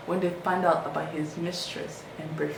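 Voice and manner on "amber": ordinary talk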